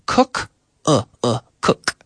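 "cook" with short rounded vowel, isolated; short double O. Shown as a demonstration. Teacher shows double O possibilities, short vowel in "cook". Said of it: "different pronunciation possibilities".

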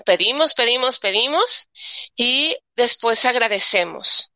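usual speaking voice

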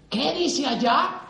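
slow, drawn-out wondering question; puzzled guest. Retold or imitated. Visiting guest staring up at the sign, slow and puzzled. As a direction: read the sign slowly, puzzled.

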